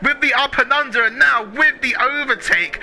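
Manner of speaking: excited. Real, not acted. Live overtake call.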